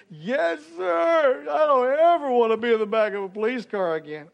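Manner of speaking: put-on high, loud promise of a scared kid; earnest little boy. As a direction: swear it like a shaken ten-year-old. Boy's earnest promise, voiced.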